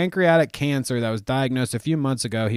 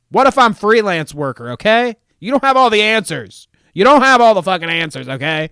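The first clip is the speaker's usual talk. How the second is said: loud, high-pitched indignant rant; mock outraged shout; 7 semitones higher, 11 dB louder than usual. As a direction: blow up at the heckler, hammed-up yelling. Blows up at chatter's challenge.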